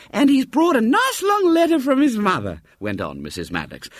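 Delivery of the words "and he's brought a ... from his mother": put-on much higher sweet old lady voice; motherly lady. Mrs Maddox greeting the boy at the door, high and motherly.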